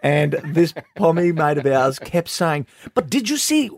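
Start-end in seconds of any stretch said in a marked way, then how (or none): 2.9-3.7 put-on English accent. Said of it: "I can't do an English accent".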